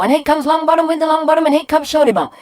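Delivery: fast, high, loud horse-race call building in excitement; breathless commentator nearing the finish; racing commentary. Acted. Boy commentating on drips racing down mirror, excited race call. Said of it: "start doing a running commentary".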